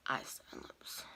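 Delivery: soft trailing whisper. Real, not acted. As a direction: whisper the last words. Whispered trailing list during makeup.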